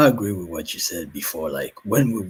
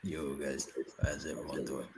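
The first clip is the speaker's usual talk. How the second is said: faint and distant, far from the mic; soft voice coming from far away; very quiet; 4 semitones lower, 11 dB quieter than usual. Real, not acted. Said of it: "you sound very quiet, very far away".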